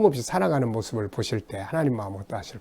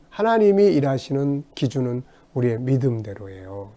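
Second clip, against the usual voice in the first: normal talking voice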